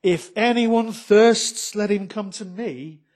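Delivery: loud, slow call on thirsts; Jesus calling out to the crowd at the feast, loud and slow; crying out. A narrator's reading. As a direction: proclaim it loudly to the whole crowd. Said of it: "Jesus stood up and cried out".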